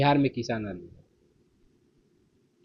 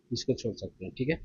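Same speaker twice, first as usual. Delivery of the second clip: इसको छोड़ सकते हैं said much lower and softer; a hushed, lower repeat of the instruction; quiet low aside; 3 semitones lower, 9 dB quieter than usual. Real, not acted. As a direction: drop the voice low and soft on the repeat. Teacher repeats skip instruction.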